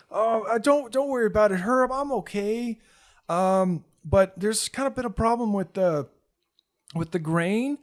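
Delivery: put-on high, bright-faced boy voice; cheerful hurt kid. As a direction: brush off his injuries with a smile. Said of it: "kinda still smiling".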